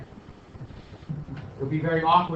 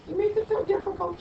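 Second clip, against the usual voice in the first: high mocking whine, softer; mock whiny student; 12 semitones higher, 5 dB quieter than usual. Teacher mocking students' complaint in a high whine. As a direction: mimic a complaining kid, pitched up.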